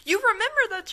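loud, high disbelieving exclamation; incredulous. Real, not acted. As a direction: sound amazed at the nonsense. Incredulous at game dialogue.

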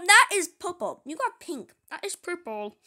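usual speaking voice

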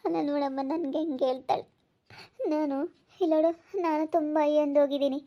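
hurt, tearful complaint with catches of breath. Acted. Aunt telling family how she is talked about, tearful. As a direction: complain through mild weeping. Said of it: "ಏನಾಯ್ತು ಅಂತ ಅಳ್ತಾ ಇದ್ದೀರಾ".

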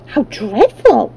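shocked. A narrator's reading. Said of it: "said Dorothy".

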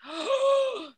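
high, loud intake of breath; big audible gasp in retelling; sharp gasp. Retold or imitated. Looking back on the idea, a big gasp.

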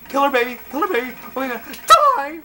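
high frantic yelps of panic; panicked screaming. Real, not acted. Panicked reaction to killer baby.

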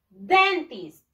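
"Dentist" loud and drawn out, DEN-tist; model pronunciation. Shown as a demonstration. Teacher giving pupils a word to repeat, "Dentist" as loud DEN-tist. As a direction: model "Dentist" with a strong first syllable, falling after. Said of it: "Repeat after me".

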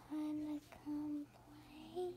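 soft creepy invitation; creepy ghost voice inviting someone to play. Acted. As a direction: lure them in, creepy and soft. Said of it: "You're getting too creepy".

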